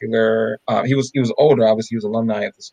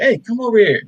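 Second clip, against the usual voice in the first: raised, high-pitched retold call; 11 semitones higher, 3 dB louder than usual. Calling the older alumnus over to the friend, calling out.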